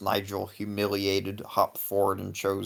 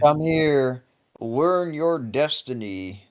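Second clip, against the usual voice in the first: mystic vendor voice; 6 semitones higher, 4 dB louder, slower than usual. Acted. Fortune vendor calling out.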